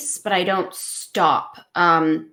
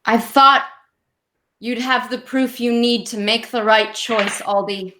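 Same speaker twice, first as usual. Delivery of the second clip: slightly raised, deliberate speech with long pauses; reproachful sibling in confrontation; 4 semitones higher than usual. Acted. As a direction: lay out the reproach piece by piece. Player speaks in character to sister.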